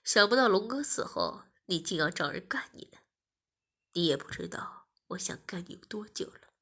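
low taunting male character voice; male masseur figure, taunting. A narrator's reading. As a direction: speak low, taunting the listener.

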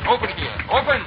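high barked order from outside the door; shouted command. Acted. Officer outside the inn demanding entry, shouting. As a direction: pound out the demand to open.

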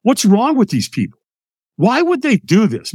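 high-pitched, mock-appalled disbelief; incredulous. Man's brain reacting to people walking on fire, incredulous. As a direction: question it all as if they've lost their minds. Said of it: "my brain is like".

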